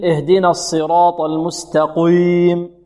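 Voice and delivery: "المستقيم" chanted with a thick "qoy" for "قي"; slow verse with exaggerated heavy q in "المستقيم"; wrong heavy qaf. Shown as a demonstration. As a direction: recite "المستقيم" wrongly, darkening the long i after q. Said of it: "مثل".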